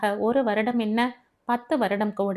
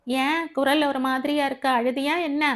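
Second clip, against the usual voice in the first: higher-pitched roommate's voice; concerned Devi; 5 semitones higher than usual. Acted. Devi asks Abi whether she has cried, higher concerned voice. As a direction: question a friend with worry, pitched up.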